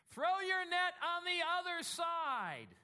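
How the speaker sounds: slow, high and drawn-out command; calm Jesus. Acted. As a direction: lay the instruction out slowly from the shore. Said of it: "And he goes".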